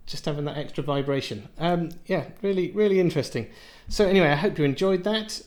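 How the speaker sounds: ordinary delivery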